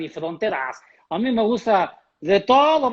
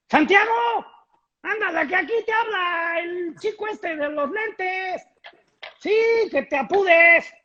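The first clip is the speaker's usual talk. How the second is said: calling out; 9 semitones higher than usual. Acted. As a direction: yell for him to hurry up. Calling Santiago from afar.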